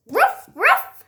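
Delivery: high, loud playful barks; dog barking. Pretend. Toy dog answering its friend, high playful barking. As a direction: bark like a tiny toy puppy.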